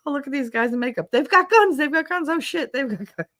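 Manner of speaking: high, fast, mock-alarmed soldiers; enemy soldiers ambushed by paratroopers in makeup; startled soldiers. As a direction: yelp it like spooked troops. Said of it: "they're just like".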